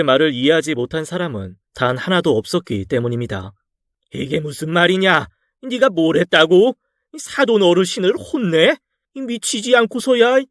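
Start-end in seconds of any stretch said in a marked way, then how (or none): 4.1-10.4 a father outraged at what his daughter did; furious outburst. A narrator's reading. 딸에게 격분한 장인, 높아진 분노의 목소리. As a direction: raise the voice in shocked fury at his daughter.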